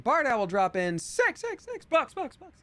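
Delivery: high, self-made echo on each word; mock announcer echo. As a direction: call it out high, repeating like a fading echo. Hyped drop-in callout, echoed words.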